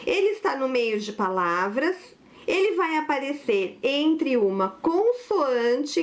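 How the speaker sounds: normal talking voice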